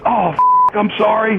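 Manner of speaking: high, loud imitated apology; mock apology. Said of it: "And he goes".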